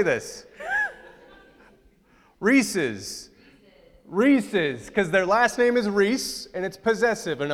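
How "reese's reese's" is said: ordinary talk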